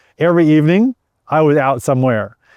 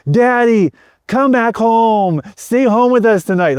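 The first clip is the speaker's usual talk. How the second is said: put-on much higher, slow tearful child's plea; pleading children; 9 semitones higher, slower than usual. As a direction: beg Daddy to come home like a weeping small kid. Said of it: "they're pleading with me powerfully".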